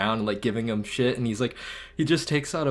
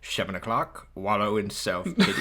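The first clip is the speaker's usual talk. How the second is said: lightly voiced movie grouch reading his schedule; Grinch impression. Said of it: "That was such a good impression".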